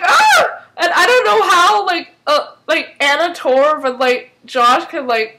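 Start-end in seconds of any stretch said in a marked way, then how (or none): 0.0-0.7 very high, loud short shriek; imitated startled scream; mock scream. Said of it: "we're like".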